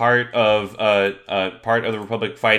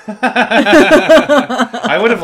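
laughing; 10 semitones higher, 9 dB louder, much slower than usual. Real, not acted. Laughing at the drawing.

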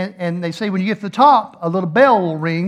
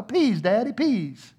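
put-on baby-talk begging; imitated pleading daughter; 4 semitones higher, 4 dB quieter, slower than usual. Little daughter begging dad to ride, imitated baby talk. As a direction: plead like a tiny child. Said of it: "your daughter looks at you".

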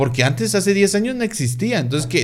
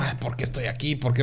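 soft, whiny mock lament, much quieter; mock crying complaint; 2 semitones lower, 8 dB quieter, faster than usual. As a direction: imitate someone whimpering why am I here. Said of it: "que esté llorando así como". (queja llorosa fingida, mucho más baja)

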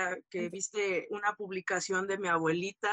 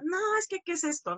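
high-pitched imitation of a dismayed protest; mock dismayed confrontation; retold upset mother; 9 semitones higher, faster than usual. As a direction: voice the mother objecting, pitched well up.

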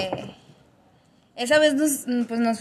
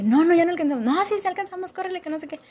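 retold hurried bickering; 7 semitones higher, faster than usual. Retold argument about catching metro.

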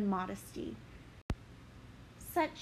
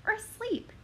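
much higher anxious question; fearful; 11 semitones higher than usual. Acted. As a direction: dread the answer. Fearing him dead.

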